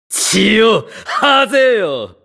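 high-pitched, frenzied villain cry; bloodthirsty command, voice pitched right up; exultant villain. Acted. (狂喜した高い声で命じる悪役)

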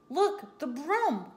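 high, loud exclamation; crying out. A narrator's reading. Bat spotting the broom, crying out. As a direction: call out in alarm, pitch jumping up. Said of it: "cried the bat".

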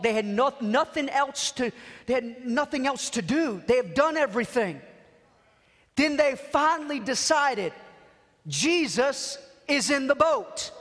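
normal talking voice

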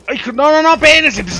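very high, very loud frightened cry; real panic, voice cracking high; panicked yell. Real, not acted. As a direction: shriek at the swarm to hold on. Monsters swarm him in game.

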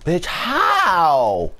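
high, drawn-out yell falling away; incredulous high shout; outraged yell. Real, not acted. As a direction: yell it in disbelief, stretching the last word. Outraged at being killed.

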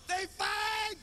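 high, loud yell; shouted announcement. Retold or imitated. Daughter yelling out to announce the fight. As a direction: holler it out to the neighborhood. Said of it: "My daughter".

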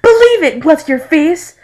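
high, loud cartoon shout; hammed-up brash outburst; brash retort. Blond character's brash catchphrase retort.

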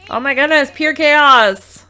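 loud, high cry of overwhelm; loud exasperated exclamation at the chaos; exasperated outburst. Real, not acted. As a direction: throw up your hands at the chaos, loudly.

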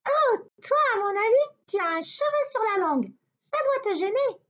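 high, quick teasing rabbit; very high cheeky bunny; little rabbit voice. Acted. Rabbit examines the wolf. (voix aiguë de petit lapin)

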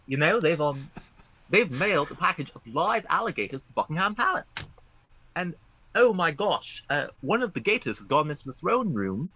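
put-on Cockney accent. Urged by a friend, a pretend Cockney telling royal gossip. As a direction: spin the royal tale like a cheeky Cockney. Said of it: "Oh, do the accent".

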